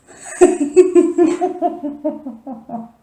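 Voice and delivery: high loud burst of laughter. Real, not acted. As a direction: laugh hard at yourself. Big laugh at herself.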